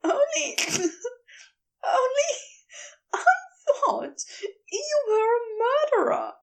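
high, giggling through the confession; laughing. Acted. Aziraphale confessing to Crowley, still laughing. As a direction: gasp it out between giggles. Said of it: "his laughter wouldn't die down".

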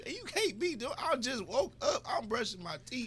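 high-pitched, laughing, desperate to leave; high laughing mock panic. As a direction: squeal it out, begging to get away. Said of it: "you like".

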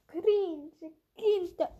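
loud, high taunting call; mocking shout. Real, not acted. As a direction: jeer at the players loudly. Spottet über Gegner. (spöttischer Ausruf)

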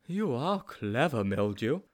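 slow, impressed character voice; Sponge, admiring. A narrator's reading. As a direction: savour the compliment to a cleverer friend. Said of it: "said Sponge admiringly".

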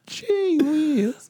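put-on high old-timer exclamation; old prospector. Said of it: "Mine sounds like an old prospector".

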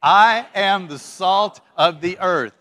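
loud, slow, emphatic declaration; big heartfelt rallying line. Shown as a demonstration. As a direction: lead the crowd word by word, loud and slow. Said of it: "with just a little bit more heart".

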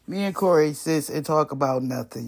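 ordinary delivery

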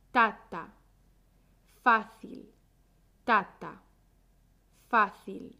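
"tata, fácil" as matching beats, TA-ta, FA-cil; rhythm drill, strong-weak. Shown as a demonstration. Teacher drilling the stress for learners, "tata, fácil" TA-ta, FA-cil. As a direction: tap out "tata" and "fácil" with the first syllable strong. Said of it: "La sílaba fuerte es".

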